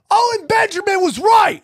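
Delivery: high parting yell; imitating a heckler's parting shout; mock shout. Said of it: "And they go".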